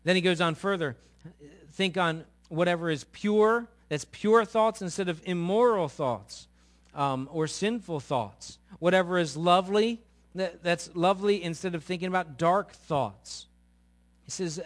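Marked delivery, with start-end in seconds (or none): 1.7-13.5 raised, ringing sermon voice; loud, lifted preaching of the verse; preaching scripture. Acted. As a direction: proclaim it to the congregation. Preaching scripture at full force.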